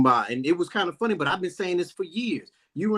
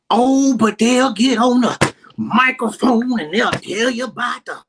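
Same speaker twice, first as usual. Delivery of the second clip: loud, slow, mocking the preachers; 4 semitones higher, 7 dB louder, slower than usual. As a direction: drag it out loud like a sermon mock.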